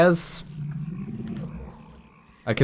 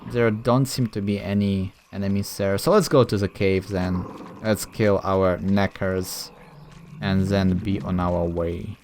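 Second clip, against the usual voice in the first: normal talking voice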